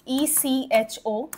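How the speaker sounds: slow dictation; slow spelling. Shown as a demonstration. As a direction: spell E C H O out slowly, letter by letter. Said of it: "spelling a word for class".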